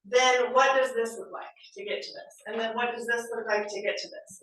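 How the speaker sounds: ordinary delivery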